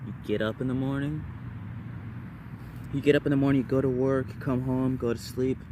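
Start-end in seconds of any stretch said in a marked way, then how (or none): none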